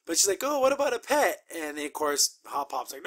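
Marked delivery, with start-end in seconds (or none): 0.4-1.4 high, sweet pleading voice; sweet pleading Anne. Retold or imitated. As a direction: coax Hop Hop for a pet. Anne begging to keep pet.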